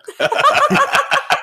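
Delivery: sudden high squealing laugh; high burst of laughter. Real, not acted. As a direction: let out a high shriek of a laugh. Cohost joke lands.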